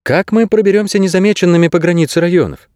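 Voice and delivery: put-on high-pitched young heroine; voiced girl character. A narrator's reading. Narrator voices the girl Kase.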